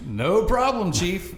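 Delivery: high, eager retold reply; eager acceptance. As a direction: jump at the offer, playfully keen. Acts eager reply to free drinks.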